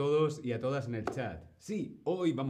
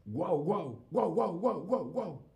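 higher playful barking; dog barking; 5 semitones higher than usual. Shown as a demonstration. Teacher barking to show the Spanish dog sound. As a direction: bark like an excited dog. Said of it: "guau es como se escribe".

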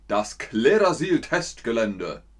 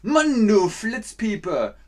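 scolding; 6 semitones higher, 4 dB louder, slower than usual. Shown as a demonstration. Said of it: "Was haben wir gesagt".

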